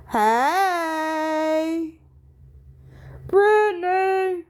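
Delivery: sing-song greeting to a viewer joining the live; calling out. Real, not acted. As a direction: stretch the name out like calling across a room.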